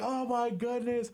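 high, slow amazed gasp of joy; awed delight. Retold or imitated. Whole crowd marveling at the record kick, high slow awe. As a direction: marvel at it together.

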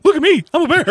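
high, loud mock bear voice. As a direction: play a goofy mascot bear. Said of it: "All right, go".

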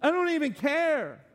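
high, offhand brush-off; dismissive God. Retold or imitated. God shrugging off the hand-washing ritual, dismissive. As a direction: toss it away like it means nothing. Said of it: "and God's like".

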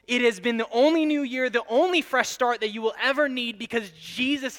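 loud, high impassioned preaching; preaching at full force. Acted. Preacher to congregation, impassioned and loud. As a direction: drive the point home to the crowd. Sermon climax.

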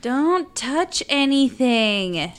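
slow, drawn-out loud command; slow hypnotic command. Pretend. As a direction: stretch it out like a spell. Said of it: "whisper in your ear".

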